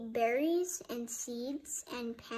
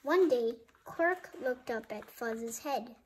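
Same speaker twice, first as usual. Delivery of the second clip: ordinary delivery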